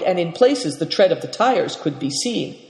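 usual speaking voice

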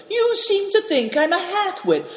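very high, outraged snarl; high-pitched angry accusation; snarling indignation. A narrator's reading. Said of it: "snarled Greencoat".

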